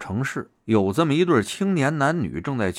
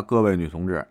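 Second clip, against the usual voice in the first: usual speaking voice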